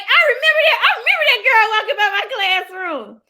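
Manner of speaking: high, loud, fast gush with a laugh; gushing as a teacher remembering a famous former student; excited recognition. Retold or imitated. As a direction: squeal it like spotting a celebrity. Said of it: "I was like".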